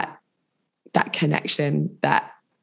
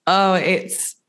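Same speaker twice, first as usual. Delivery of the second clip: usual speaking voice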